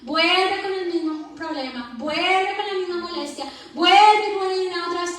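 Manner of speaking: rising pulpit exhortation; fervent preaching. Acted. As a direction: preach it to the congregation with fire. Preaching at full force.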